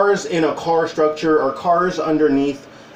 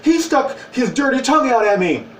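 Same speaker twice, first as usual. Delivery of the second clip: put-on upset child; 7 semitones higher than usual. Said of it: "tried to tell the mom".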